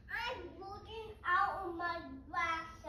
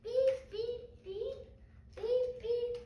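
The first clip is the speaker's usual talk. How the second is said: kid's mock horn beeps; toy car beeping; 3 semitones higher than usual. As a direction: honk like a little car.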